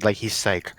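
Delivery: usual speaking voice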